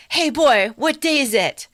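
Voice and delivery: put-on old man calling down to the street; Scrooge calling out. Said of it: "he says to a boy on the street".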